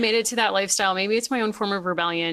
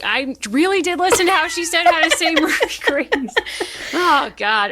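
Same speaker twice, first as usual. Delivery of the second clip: talking through real laughter, higher and louder; laughing; 9 semitones higher, 6 dB louder than usual. Real, not acted. Host cracking up at her own mistake, laughing.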